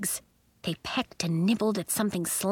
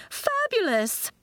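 very high, stretched-out exclamation; exclaiming; 13 semitones higher, slower than usual. A narrator's reading. Miz Fitznickel admires Nori's flamingo change, exclaiming. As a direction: draw the word out high with delight. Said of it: "Miz Fitznickel exclaimed".

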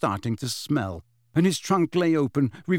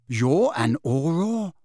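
slow, higher, impressed question; 4 semitones higher, slower than usual. A narrator's reading. Harry learning the witch's job, impressed. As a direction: draw it out in awe.

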